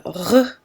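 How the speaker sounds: "r" scraped from the back of the throat; guttural French "r". Shown as a demonstration. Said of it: "is like hawking a loogie".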